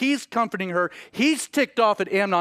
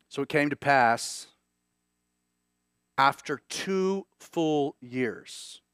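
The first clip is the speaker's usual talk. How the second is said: drawn-out, very slow, each word weighed; slow scripture reading; 5 semitones lower, 3 dB quieter, slower than usual. Acted. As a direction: let the verse hang in the air slowly. Reading the verse, then repeating it.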